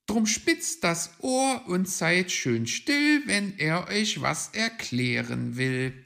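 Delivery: high, loud, measured recital; performed verse. Acted.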